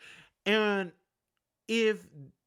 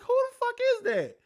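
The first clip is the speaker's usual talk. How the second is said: retold surprise; 4 dB louder, faster than usual. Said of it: "I was like".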